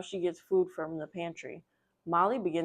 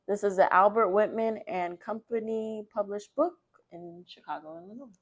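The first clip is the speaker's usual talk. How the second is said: lively, louder picture-book read-aloud; reading to children; 6 dB louder than usual. A narrator's reading. Librarian reading a picture book to children, lively and loud. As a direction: read it out brightly to the kids.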